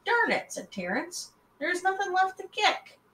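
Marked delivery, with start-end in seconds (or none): 0.1-0.5 frustrated. Acted. Frustrated exclamation. 1.6-3.0 whiny. Acted. Whiny complaint.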